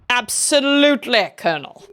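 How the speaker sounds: eager British subordinate, high then dropping; British accent. Acted. Subordinate answers the Colonel, British accent. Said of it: "I'm doing British accents".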